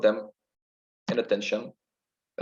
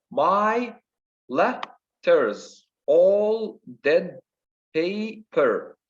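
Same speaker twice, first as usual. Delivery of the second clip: "My letters, all dead paper" in slow, separated beats; stressed-unstressed rhythm; 6 semitones higher, 4 dB louder, much slower than usual. Shown as a demonstration. Teacher showing the sonnet's rhythm, stressed and unstressed beats. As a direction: beat out "My letters, all dead paper" strong then weak. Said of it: "stress, unstressed".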